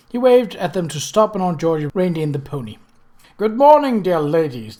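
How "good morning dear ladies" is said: a lightly voiced continental stranger, humble and bright; humble continental gentleman. A narrator's reading. Stranger greets the ladies from the roadside, humbly polite. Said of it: "the man said humbly".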